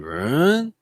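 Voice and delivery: "Run" rising up at the end like a question; rising upspeak. Shown as a demonstration. Teacher demonstrating upspeak on "Run". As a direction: tilt "Run" upward like asking. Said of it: "your voice is going".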